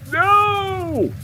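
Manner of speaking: a very high held no; emphatic no. Pretend. Streamer protesting the imagined bug message with a very high no. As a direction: cry out in playful horror. Rejecting feared game bug.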